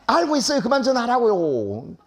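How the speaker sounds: high, stretched-out mock protest; acted exasperation. Husband snaps back at repeated calls. (짜증 난 남편 흉내, 높고 길게 끄는 말투)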